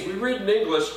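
ordinary delivery

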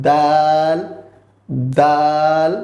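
দাল with the long vowel held; drawn-out letter. Shown as a demonstration. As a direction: stretch out the middle of দাল. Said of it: "একটু টেনে বলবো". (দাল একটু টেনে বলা)

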